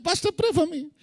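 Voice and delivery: high mock plea, imitating a begging believer; mock needy churchgoer. Preacher mimicking a congregant begging the pastor, high and pleading. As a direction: whine it up high like a clingy member. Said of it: "Every time".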